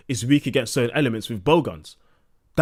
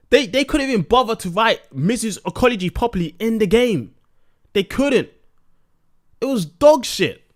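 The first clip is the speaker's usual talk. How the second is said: heated rant; 9 semitones higher, 5 dB louder than usual. Real, not acted. Heated rant about ecology.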